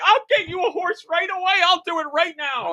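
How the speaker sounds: high, eager rushing promise; eager mock promise. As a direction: promise it like an overeager helper. Eager self-quote helping NPC.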